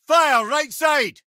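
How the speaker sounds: high, slow battle shout; shouted callout. Acted. Mimir calling out an attack to the boy, loud shout. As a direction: bark the warning across the fight.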